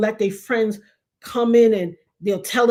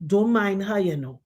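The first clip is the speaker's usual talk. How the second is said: soft reassuring; 6 semitones lower, 4 dB quieter than usual. Retold or imitated. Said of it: "She said".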